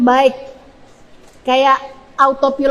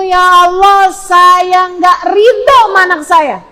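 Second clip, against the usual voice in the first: raised, louder, drawn-out outburst; rejecting prayer; 4 semitones higher, 5 dB louder, slower than usual. Shown as a demonstration. Parent disowning the child before God, raised forceful cry. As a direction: push it out high and slow. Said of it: "Jangan sampai keluar dari mulutmu".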